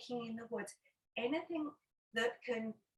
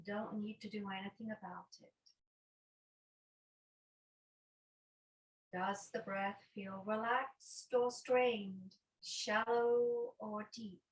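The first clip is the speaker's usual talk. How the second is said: guided meditation. Acted. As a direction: guide them unhurriedly into noticing the breath. Leads a breathing meditation.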